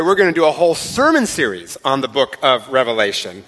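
usual speaking voice

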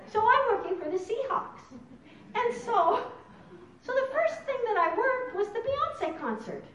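bursting with delight at the story; excited laughing. Real, not acted. Excited Seahawks job news.

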